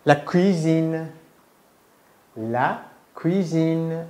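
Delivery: La cuisine said very slowly, cui and sine drawn apart; teacher models La cuisine slowly for the student to copy; La cuisine spoken very slowly; slow French model. Shown as a demonstration.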